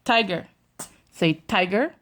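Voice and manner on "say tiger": normal talking voice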